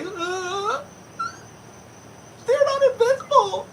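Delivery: very high, wailing fake cry. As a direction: overplay a tearful stage plea. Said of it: "she goes on stage and she's like".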